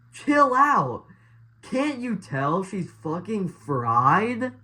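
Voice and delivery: high, loud mock yell, drawn out; imitated movie yell. Football guy telling off the other survivor, imitated yell. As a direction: yell it like badly acted dialogue. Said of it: "just yells to him".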